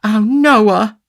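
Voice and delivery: high, loud feminine protest; hurt sweetheart's high-voiced scolding; reproachful. A narrator's reading. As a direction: cry out wounded reproach, pitched high. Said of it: "cried Charlotte reproachfully".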